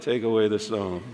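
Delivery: low, soft and mellow; calm and mellow. Shown as a demonstration. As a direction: let it out gently, unhurried. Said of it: "so calm, so mellow".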